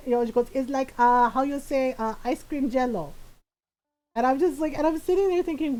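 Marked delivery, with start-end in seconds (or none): 0.5-3.1 put-on hesitant Filipino-accented mom; mom's Filipino accent. Mom hunting for a dessert's name, in her Filipino accent. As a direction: imitate a mom groping for the word in a Filipino accent. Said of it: "in my mom's voice".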